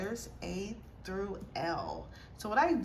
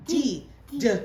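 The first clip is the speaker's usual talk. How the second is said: loud, slow letter sounds; 7 dB louder than usual. Real, not acted.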